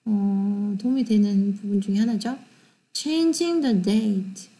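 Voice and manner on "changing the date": very slow, careful English reading; slow model sentence. Shown as a demonstration. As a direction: say it slowly for repetition. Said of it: "compare the title of the pictures".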